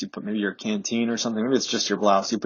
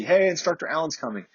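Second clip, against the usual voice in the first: higher-pitched mild mock holler; mock call-out; 7 semitones higher than usual. Watchers alerting class leaders that an instructor is coming, mock scream. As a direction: relay a warning, calling out lightly. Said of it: "they'll scream out to the L P O".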